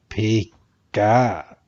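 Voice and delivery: a quick mock "Pika" from the Pokémon; Pikachu voice. Recapping the episode, voicing Pikachu's reply with a light mock cry. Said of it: "And Pikachu's like".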